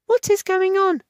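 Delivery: baffled; Wendy puzzled as they rush by. A narrator's reading. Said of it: "puzzled Wendy".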